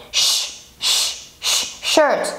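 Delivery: "Sh" a long hissed sh; sh sound. Shown as a demonstration. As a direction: hiss "Sh" then "shirt". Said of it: "In English, you have the shirt".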